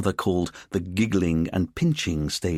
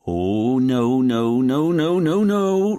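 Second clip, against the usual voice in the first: dismayed "no"s climbing higher and louder; rising alarm; 6 semitones higher, 6 dB louder, much slower than usual. Acted. Richard seeing Dwayne with the woman, rising panicked noes. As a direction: panic mounting with every no.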